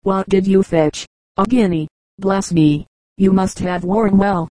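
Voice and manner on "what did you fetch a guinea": plain reading voice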